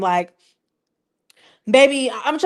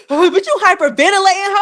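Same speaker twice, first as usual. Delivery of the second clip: laughing through the words, higher; laughing tease; 6 semitones higher than usual. Real, not acted. Vlogger laughing at Hurricane M's panic. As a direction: giggle as you ask it.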